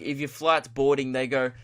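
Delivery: ordinary talk, nothing marked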